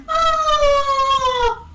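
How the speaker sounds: long high "aah"; mock falling scream. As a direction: imitate a scream coming down from the sky. Imitates scream from the sky.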